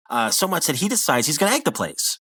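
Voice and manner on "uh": usual speaking voice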